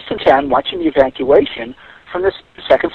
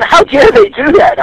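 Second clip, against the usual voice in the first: higher, slow mock outrage; mock indignation; 7 semitones higher, 3 dB louder, slower than usual. Late commuters in the elevator complain, imitated with mock outrage. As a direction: imitate their huffy disbelief.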